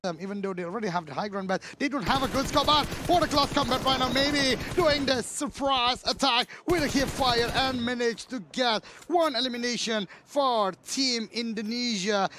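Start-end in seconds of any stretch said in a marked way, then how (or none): 1.8-12.3 excited shouting. Real, not acted. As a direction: yell the play with rising excitement. Caster hypes close combat kill.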